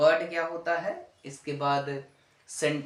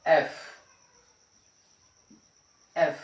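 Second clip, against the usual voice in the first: ordinary talk, nothing marked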